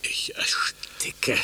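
slow choked whisper. Acted. Balduin left behind, choking whisper. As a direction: barely get the words out. Despair after women leave.

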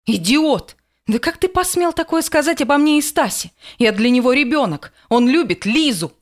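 loud, high furious shout; yelling at full voice. A narrator's reading. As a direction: scream it at him with all your voice. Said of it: "заорала во весь голос".

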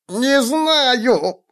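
high, pleading cry; high frantic denial; squealing halfling. A narrator's reading. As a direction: beg to be let go.

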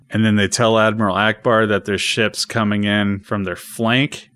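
usual speaking voice

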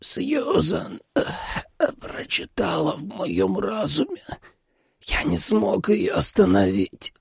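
high, slow, strained and weak; pained dying man. A narrator's reading. Wounded father confessing about Susan, pained and weak. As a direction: voice a wounded man labouring to speak. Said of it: "Он застонал от боли".

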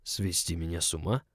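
soft, hushed baritone question; sagging baritone. A narrator's reading. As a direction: let the voice sink under pressure. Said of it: "Баритон моего начальника, ... сел". (севший баритон, тихо)

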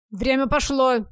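loud barked order; barked again. A narrator's reading. Husband barks the deadline again, loud. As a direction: snap out the deadline loudly. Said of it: "вторично рявкнул он".

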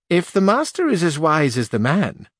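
a stiff duenna introducing herself; old duenna answering Sancho, high and prim; haughty old duenna. Acted.

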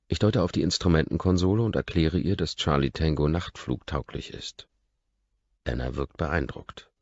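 plain reading voice

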